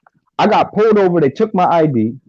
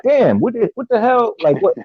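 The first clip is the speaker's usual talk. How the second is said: higher-pitched, retold bafflement at being hassled; exasperated disbelief; 7 semitones higher than usual. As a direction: act out a baffled protest. Retelling reaction to repeated police stops.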